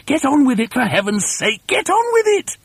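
high, frantic shouted orders; high-pitched urgent bossy rant; frenzy of excitement. A narrator's reading. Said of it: "in a frenzy of excitement".

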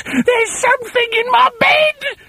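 put-on very high frantic old wife; panicked hag. A narrator's reading. Wife panics about the frog, high frantic shriek. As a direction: shriek it in terror.

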